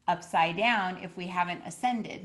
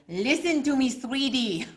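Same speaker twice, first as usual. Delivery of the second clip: put-on higher, louder Argentinian-accent coaxing; Argentinian-accented healer; 7 semitones higher, 7 dB louder, slower than usual. Argentinian healer calming a frightened client. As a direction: soothe her like an Argentinian healer. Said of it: "really strong ... kind of accent".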